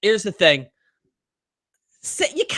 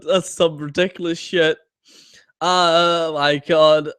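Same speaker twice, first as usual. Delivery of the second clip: normal talking voice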